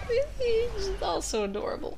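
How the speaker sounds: high, slow, drawn-out cooing; playful gushing. Retold or imitated. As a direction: gush as if utterly charmed. Retold gushing over peasants' speech.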